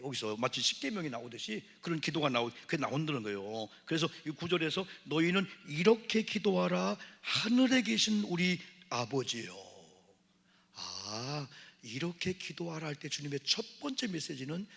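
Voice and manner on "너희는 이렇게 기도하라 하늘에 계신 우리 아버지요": slow, louder solemn recitation of the Lord's prayer; preaching scripture. Acted. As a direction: proclaim it to the congregation, drawing it out. Preacher proclaiming.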